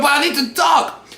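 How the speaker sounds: mocking the girl who wants to talk, high pitched; mock whiny girl. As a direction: squeak it out like a begging girl. Mimicking the crying girl.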